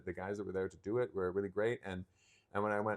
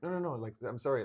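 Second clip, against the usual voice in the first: high, hurried backpedalling; acted-out panicky apology; flustered apology; 4 semitones higher, 3 dB louder, faster than usual. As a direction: scramble to take it back. Retold hasty apology after swearing.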